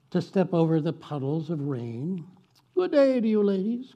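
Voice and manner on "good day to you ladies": higher, louder gallant hello; gentleman's greeting. Acted. Gentleman calls to passing ladies, higher and louder. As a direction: call out a cheerful hat-tipping greeting. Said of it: "called a gentleman".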